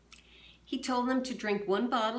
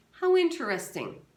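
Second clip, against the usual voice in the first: sarcastic; 8 semitones higher, 8 dB louder than usual. Acted. Marengo's sarcastic retort.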